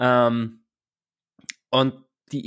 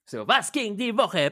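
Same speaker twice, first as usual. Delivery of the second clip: podcast host voice; 8 semitones higher, slower than usual. Acted. Asks weekly question, show style.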